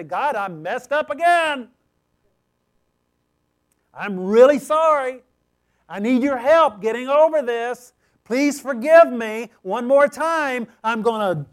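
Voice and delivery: loud, high cry to God; pleading confession. Acted. Sinner confessing to the Lord, loud earnest pleading. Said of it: "begin to confess that before the Lord".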